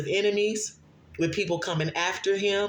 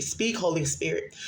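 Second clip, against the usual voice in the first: ordinary talk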